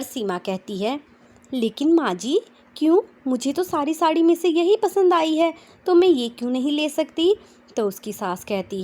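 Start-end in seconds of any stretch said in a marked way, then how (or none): 1.5-7.3 higher, louder pleading why; girlish protest. A narrator's reading. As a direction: whine to the mother-in-law. Bride protests to mother-in-law.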